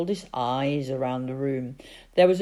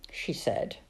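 usual speaking voice

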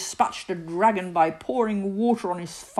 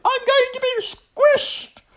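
put-on high, loud boyish wail; panicked squeal at full voice; shouting; 9 dB louder than usual. A narrator's reading. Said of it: "he shouted".

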